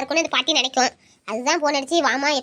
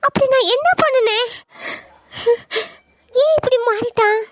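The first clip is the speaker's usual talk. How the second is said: hurt lament; 7 semitones higher than usual. Acted. Girl upset, alone, over friend.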